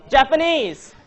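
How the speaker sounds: loud, high, amused shout-out; calling out. Retold or imitated. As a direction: announce the funny answer loudly to the room. Calling out a student's funny answer.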